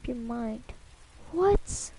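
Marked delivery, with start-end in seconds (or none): 1.3-1.9 high, loud, alarmed what and no; shocked outburst. Acted. Mother hearing her child will drive, shocked and loud. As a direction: blurt it out in disbelief.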